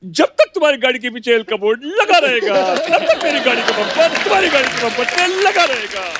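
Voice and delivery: Jeetendra's high comic film-hero banter. Retold or imitated. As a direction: tease the rival driver playfully, pitched high. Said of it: "उनका comedy वाला mood है".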